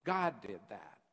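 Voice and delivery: ordinary delivery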